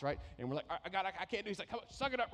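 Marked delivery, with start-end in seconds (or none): 0.7-1.5 higher, straining overloaded voice; buckling under the boxes; mock strained plea. Believer crushed under boxes begs God, strained. 1.7-2.3 much higher, bossy taunting push; a coach who won't let up; mock pushy God. God voiced by the pastor, goading the burdened believer.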